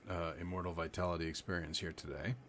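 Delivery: ordinary talk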